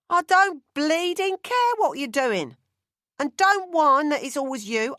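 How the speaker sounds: loud, high, exasperated shout; harried mother shouting orders at her son; angry yelling. Acted. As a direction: scold him at full volume, fed up.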